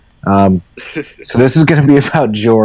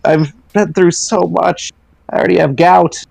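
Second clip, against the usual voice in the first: higher, half-laughing mock terror; terrified whining; 8 semitones higher than usual. George begs the crowd, higher mock-terrified voice. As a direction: whimper out the questions. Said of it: "and George is terrified".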